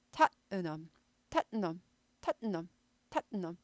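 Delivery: tottenham with a stop after tat; Tottenham demo. Shown as a demonstration. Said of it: "스타카토 한 다음에 은 하는 거예요".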